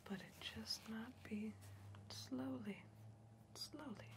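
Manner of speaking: soft unvoiced whisper, close and intimate; hushed soothing whisper; ASMR whisper. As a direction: breathe it like an ASMR video.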